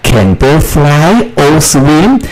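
high, slow questioning read; slow model question. Shown as a demonstration. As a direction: ask it slowly for the class. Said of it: "用can表示能力来形成问句". (高音慢速示范问句)

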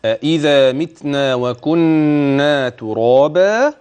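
أإذا متنا وكنا ترابا slow, with the نّ of وكنا held; slow teaching recitation. Shown as a demonstration. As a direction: model the verse slowly to be repeated. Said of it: "قولي ورايا كده". (تلاوة بطيئة للترديد)

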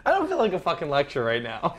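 normal talking voice

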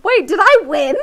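high, loud, laughing and half crying; giddy shock at winning; laughing disbelief. Real, not acted. Streamer laughing in disbelief at winning the story game.

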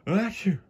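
high sudden achoo sneeze; a loud comic sneeze. Acted. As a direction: burst into a big sneeze mid-sentence. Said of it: "sneezed Thomas".

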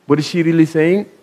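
normal talking voice

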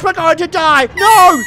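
loud, high wail; hammed-up terrified yell; mock panic. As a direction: cry out in pretend doom. Pretend plane crash panic.

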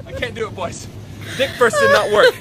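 high, laughing giving up; helpless laughter. Real, not acted. Telling the boys he can't crab walk, helpless laughter. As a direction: laugh out the defeat.

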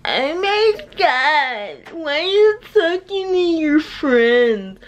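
high, slow, whiny mock of a clingy girlfriend; imitating the clingy girlfriend, high and drawn out. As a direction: drag it out like a needy girlfriend. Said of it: "she's like".

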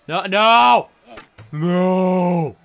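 loud, drawn-out cry of protest; dismayed wail. Real, not acted. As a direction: wail it out in despair at the game. Dismayed at turn in fight.

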